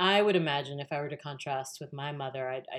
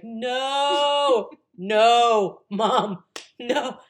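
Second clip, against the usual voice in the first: loud, high, laughing plea to stop; pleading no; 8 semitones higher, 10 dB louder, much slower than usual. Retold or imitated. Embarrassed daughter stopping her mom, loud pleading. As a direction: beg her to drop it, half laughing.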